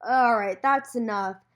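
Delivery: loud host cutting things off; stern host. Retold or imitated. Host to the audience after the uproar, loud and firm. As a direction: call it out firmly to shut down the crowd. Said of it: "then the host was like".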